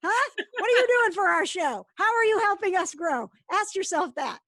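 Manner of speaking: loud, high, demanding the listeners; mock interrogation. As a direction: play it up like a pushy host.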